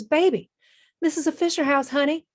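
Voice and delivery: put-on motherly Southern accent, voice lifted high. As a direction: pitch it up into a doting Southern accent, all baby and honey. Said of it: "a warm woman with a thick Southern accent".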